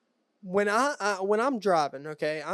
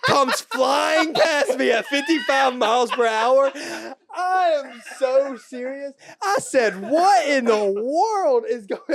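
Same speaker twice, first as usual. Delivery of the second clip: loud, high, drawn-out disbelief with laughter; incredulous excitement; 6 semitones higher, 8 dB louder than usual. Real, not acted. Host telling co-host about the speeding Wienermobile, incredulous excitement. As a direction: belt out the absurd punchline slowly. Climax of astonished highway story.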